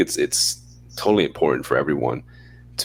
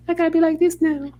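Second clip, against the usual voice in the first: much higher, squeaky put-on voice; high-pitched voice. Shown as a demonstration. Talking to the co-host so the mic picks it up, very high. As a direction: jump way up into a chirpy falsetto. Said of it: "I probably do it here sometimes".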